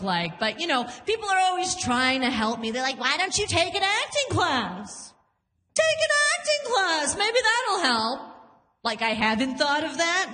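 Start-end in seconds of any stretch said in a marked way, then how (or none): 3.0-5.1 higher mock-helpful voice; mimicked advice. People try to help the actress, mock-helpful suggestion. 5.7-8.2 very high mocking mimicry; mocking advice. People advise the actress, high mocking imitation.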